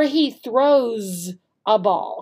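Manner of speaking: slow, settled, falling answer; confirming tone. Shown as a demonstration. Teacher confirming the correct answer, slow and settled. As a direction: say it as the sure right answer. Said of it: "showing with our tone of voice which one is the correct answer".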